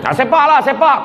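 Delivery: high, laughing dare to kick; mock challenge. Husband dares the unborn baby to kick, high and laughing.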